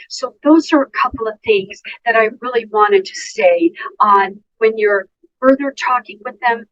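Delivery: ordinary talk, nothing marked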